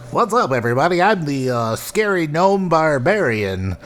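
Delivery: gnome barbarian voice. Pretend. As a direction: introduce your gnome hero. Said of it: "tabletop character with that voice".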